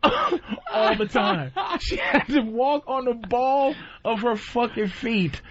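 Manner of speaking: slow, high, laughing through words; laughing while telling. Real, not acted. Telling friends about girlfriend's walk, laughing. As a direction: crack up mid-story.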